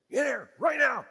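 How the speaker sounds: loud, high barked order to come home; booming command. Retold or imitated. Neighbor father booming at his son to come home. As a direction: call the boy in like a giant angry-sounding dad. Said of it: "a loud, just booming".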